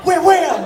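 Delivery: loud, high slam sounds; banging noise. Retold or imitated. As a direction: imitate the raccoon banging the glass. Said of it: "stood up on both legs and went".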